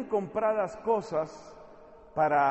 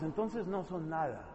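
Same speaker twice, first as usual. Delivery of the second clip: ordinary talk, nothing marked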